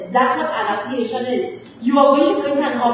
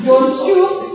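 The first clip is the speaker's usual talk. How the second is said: loud and higher, snapping an order; sharp command; 8 semitones higher, 6 dB louder than usual. Real, not acted. Teacher scolds a slouching student: loud sharp command. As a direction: bark the correction at a slouching student.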